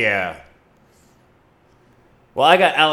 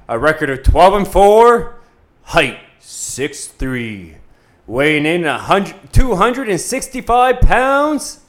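ring announcer; 5 dB louder than usual. Acted.

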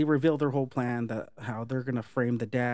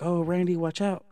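raised, higher mock cry of warning; mock warning call; 6 semitones higher, 3 dB louder than usual. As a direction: call out to Randy that the killer is behind him. Warning Randy of the killer.

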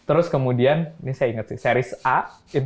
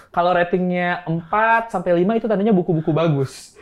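condescending imitation; 6 semitones higher than usual. Founder mimics investor explaining book ratings to him, condescending. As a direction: imitate someone spelling out basics, raised and emphatic.

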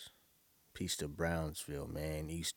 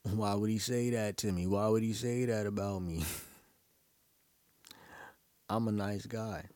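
louder, higher, playfully offended complaint; mock wounded protest; 4 semitones higher, 5 dB louder than usual. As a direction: protest your innocence in joking hurt, with a laugh. Mock protest at radio host's insult.

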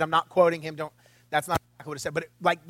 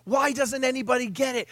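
exasperated outburst; 3 semitones higher, 3 dB quieter than usual. Retold or imitated. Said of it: "and I'm like".

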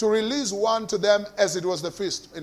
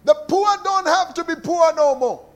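loud proclamation; 7 semitones higher, 5 dB louder than usual. Acted. Preacher proclaiming.

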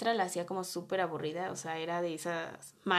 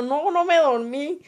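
insistent denial; 6 semitones higher, 7 dB louder, slower than usual. Retold or imitated. Retold denial to the class.

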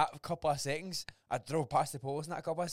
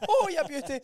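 much higher and louder, cracking up; laughing; 11 dB louder than usual. Retold or imitated. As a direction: blurt it out through a laugh. Retold reply, laughing.

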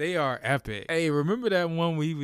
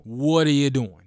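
louder disbelieving scold; exasperated loud; 9 dB louder than usual. Real, not acted. Fan scolding a player after his DUI, louder. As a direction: scold him like he should know better.

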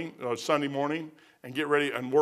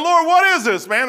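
much higher, louder cry to the Lord; mock bewilderment; 10 semitones higher, 6 dB louder than usual. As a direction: throw up your hands at God. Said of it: "I'm thinking".

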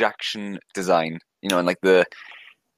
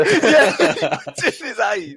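laughing; 13 semitones higher, 3 dB louder, much slower than usual. Real, not acted. Laughing at the film joke.

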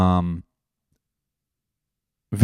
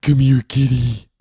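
calling the cat; 3 semitones higher, 7 dB louder, slower than usual. Real, not acted.

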